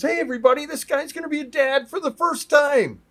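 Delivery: loud, high holler to the whole room; acted-out jubilant shout; public announcement. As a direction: call everyone's attention with big news.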